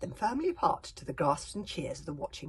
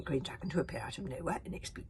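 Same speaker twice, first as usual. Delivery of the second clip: ordinary delivery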